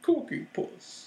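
high, soft, drawn-out silly voice; goofy high call, quietly stretched out; silly put-on voice. Joking to make the co-host laugh, silly voice. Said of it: "I need to say that into the can".

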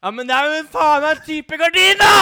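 high, loud, swearing insistence; exasperated shout. Real, not acted. Contestant loudly insisting to the others it's a kind of curtain. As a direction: shout the point home. Arguing over the answer.

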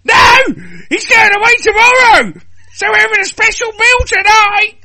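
very high, loud hammed-up squeal; excited shriek. Woman gushing to a friend about Phil, excited shriek. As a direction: shriek it at full pitch.

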